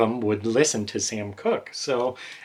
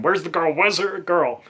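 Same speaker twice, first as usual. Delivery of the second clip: higher-pitched mock yell, not full volume; half-hearted retold screaming, pitched up; mock frantic scream; 7 semitones higher than usual. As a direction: imitate a man hollering in a panic, held back. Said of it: "screaming, you know, naked".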